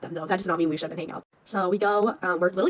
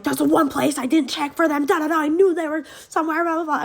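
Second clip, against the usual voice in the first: higher-pitched mock stepdad rant; retold angry rant; 7 semitones higher, 3 dB louder than usual. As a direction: imitate a stepdad telling you off. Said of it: "he was really mad about that".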